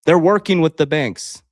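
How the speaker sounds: loud, insistent statement; emphatic call-out. Real, not acted. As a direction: drive the point home hard. Calling out the banks.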